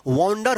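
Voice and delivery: वंडर said "wawn-dar", rounded vowel; correct "aw" vowel. Shown as a demonstration. Teacher answering with the correct pronunciation, with aw. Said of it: "इसका सही उच्चारण".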